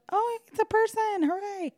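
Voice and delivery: high, gleeful cheer; a high mock cheer of relief; delighted. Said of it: "And he goes".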